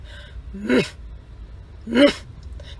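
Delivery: quick doglike sniffs through the nose; dog sniffing. Retold or imitated. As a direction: sniff around like a dog wanting attention. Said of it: "you know how dogs do that thing before they bark".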